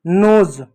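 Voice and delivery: नोज ending in buzzing z, "noze"; correct z ending. Shown as a demonstration. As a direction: close नोज on English Z, punched out. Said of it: "तो अब ये क्या होगा". (नोज़ के अंत में ज़)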